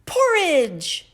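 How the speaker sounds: high, loud cub shout; little bear yelling out his favorite food; excited high shout of porridge. A narrator's reading. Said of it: "shouted Baby Bear".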